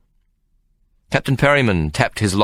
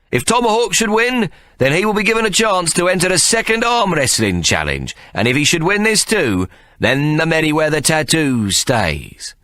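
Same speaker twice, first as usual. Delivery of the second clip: ringmaster rules; 10 semitones higher than usual. Acted. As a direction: lay out the rules like a barker. Ikey sets contest rules.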